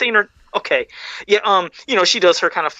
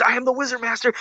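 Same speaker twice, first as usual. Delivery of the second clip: slightly hammed-up Wizard Master, higher; lightly voiced movie hero declaring himself; higher mock proclamation; 6 semitones higher, 4 dB quieter, faster than usual. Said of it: "you remember, he's".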